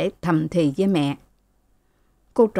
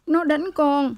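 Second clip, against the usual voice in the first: crying daughter; 9 semitones higher, slower than usual. A narrator's reading. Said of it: "Cô Lễ òa lên khóc".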